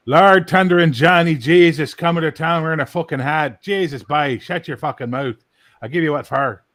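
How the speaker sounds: put-on loud, slowed Newfoundland accent, broad and rough. Maritimer showing his American friend the Newfoundland accent, loud. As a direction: rattle it off like a Newfie buddy from childhood. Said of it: "That's what they sound like".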